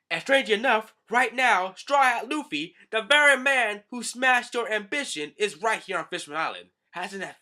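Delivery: high, loud gloating taunt; taunting shout. Acted. Villain loudly taunting Hachi about Luffy's arrival. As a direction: rub it in loudly.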